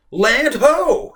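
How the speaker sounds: high, loud excited shout; excited call. A narrator's reading. Girl spotting the shore, loud and excited. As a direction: call out to everyone. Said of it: "Samantha called out excitedly".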